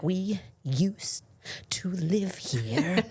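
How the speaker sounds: unvoiced whisper, very slow on "to live here". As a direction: breathe the title like a secret. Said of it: "I'm like".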